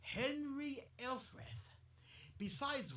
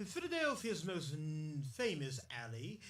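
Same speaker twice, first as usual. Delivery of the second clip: ordinary talk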